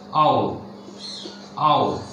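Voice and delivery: "Ow" drawn out, low and clear, a gliding ow sound; diphthong ow demo. Shown as a demonstration. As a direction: model "Ow" slowly for the class, from a to u. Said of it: "the second diphthong is ow".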